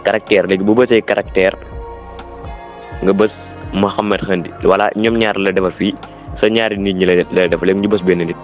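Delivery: ordinary talk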